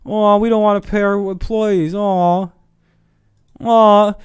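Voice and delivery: high whiny crybaby voice, lightly done; mock crybaby company. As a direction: whimper it like a spoiled child mocking the boss. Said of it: "the biggest crybaby thing".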